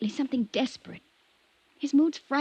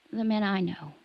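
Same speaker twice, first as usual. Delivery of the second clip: ordinary talk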